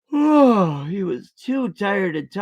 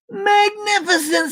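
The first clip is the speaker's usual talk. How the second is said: a delighted princess gushing; admiring princess. A narrator's reading. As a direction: marvel at it like an impressed princess. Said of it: "said Elizabeth".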